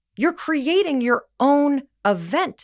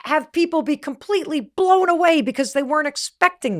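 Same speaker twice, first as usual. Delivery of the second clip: much higher and louder, mock overeager; gushing yeses; 5 semitones higher, 5 dB louder than usual. Retelling her naive yes to the production team, higher and louder. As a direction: pile on the yeses with giddy zeal. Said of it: "when I said".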